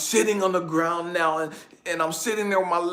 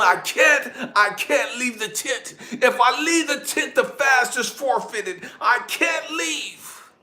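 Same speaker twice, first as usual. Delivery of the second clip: raised, pleading protest; retold desperate refusal; 5 semitones higher, slower than usual. Man tells the voice he must stay, pleading. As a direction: refuse desperately, pitch pushed up.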